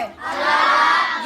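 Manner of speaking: अलग drawn out very slowly; slow drawn-out अलग for the class; stretched answer. Shown as a demonstration. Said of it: "अलग है कि same है?".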